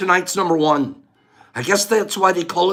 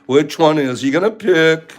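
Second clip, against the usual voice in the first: ordinary delivery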